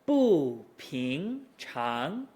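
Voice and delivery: very slow "Bu" dropping, "ping" and "chang" each rising; stretched tone demo of "Bu ping chang"; falling into rising. Shown as a demonstration. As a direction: fall on "Bu", then climb. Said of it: "We fall into the rise".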